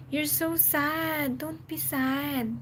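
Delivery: slow, gentle consoling of a sad viewer; tender reassurance, unhurried; soft comforting. Real, not acted. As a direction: coax them out of sadness softly and slowly.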